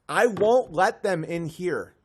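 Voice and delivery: usual speaking voice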